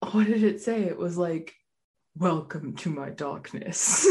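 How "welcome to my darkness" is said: slow, spooky doorbell voice; drawn-out haunted house greeting; deep scary voice. Retold or imitated. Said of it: "deep, scary voice".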